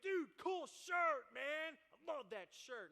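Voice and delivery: high, loud, slow drawn-out shout; bro compliment. Retold or imitated. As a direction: hype up your buddy's shirt. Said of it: "the guy's like".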